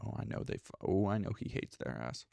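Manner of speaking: breathy whisper under the breath; whispered aside. Real, not acted. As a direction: let it slip as a whisper.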